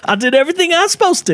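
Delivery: very high, fast exasperated squeal; a farmer at his wit's end; frustrated farmer. Pretend.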